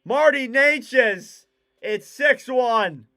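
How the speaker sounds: big slow broadcaster shout; drawn-out goal call. Acted. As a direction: savour the scorer's name.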